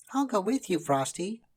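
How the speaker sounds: put-on high, loud girl's voice; little girl. A narrator's reading. Karen offering to go with Frosty. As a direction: offer to come along. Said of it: "said Karen".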